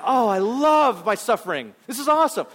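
sarcastic rapture at suffering; mock delight. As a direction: ham up loving the suffering. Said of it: "It's like".

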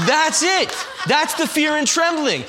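laughs it out, high and loud; bursting into delighted laughter; laughing. Real, not acted. Preacher to congregation about Queer Eye, laughing loudly.

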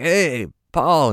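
high cool rocker greeting. Retold or imitated. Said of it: "And [name]'s like".

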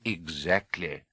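low, slow, measured reply; lightly voiced wise old wizard; wise old headmaster.

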